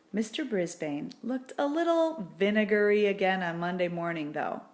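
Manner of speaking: usual speaking voice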